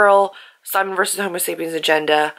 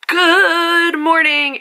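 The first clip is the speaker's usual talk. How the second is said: drawn-out sing-song greeting; 10 semitones higher, 5 dB louder, much slower than usual. Real, not acted. Cheerful drawn-out morning greeting.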